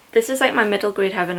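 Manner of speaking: normal talking voice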